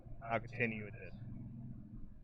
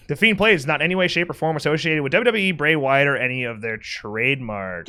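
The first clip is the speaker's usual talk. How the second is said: fast disclaimer; 2 semitones higher than usual. Acted. Rapid legal disclaimer.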